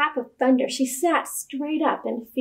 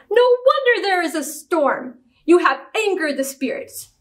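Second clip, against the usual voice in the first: high-rising indignant outburst, easing down; angry accusation; 5 semitones higher than usual. A narrator's reading. Witch doctor blaming his daughter for the storm, accusing. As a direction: lay the blame with indignation.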